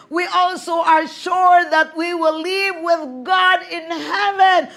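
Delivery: loud, slow preaching at full force. Real, not acted. Preacher proclaiming the verse to the congregation, exuberant preaching. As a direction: belt out the verse, stretching the last words.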